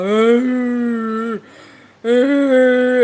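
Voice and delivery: long, loud drawn-out bull groan; imitated bull bellow. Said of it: "I just heard Big Red go".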